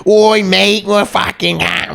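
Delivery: hammed-up British accent. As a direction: do a bad British accent, Oi mate style. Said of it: "Ah, fucking British accent".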